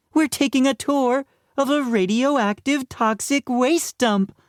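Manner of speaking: high-pitched teacher voice; teacher announcing. A narrator's reading. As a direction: announce the prank trip in a high voice.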